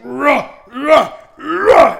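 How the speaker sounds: loud grunting charge like a T-Rex; T-Rex grunts. Retold or imitated. Woman busting the door in with her shoulder, T-Rex grunts. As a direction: ram the door, grunting like a dinosaur. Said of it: "T-Rex kinda".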